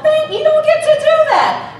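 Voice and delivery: mock scolding. Preacher mocking a pious scold, laughing high yell. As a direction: scold them half laughing. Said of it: "you don't get to say to someone".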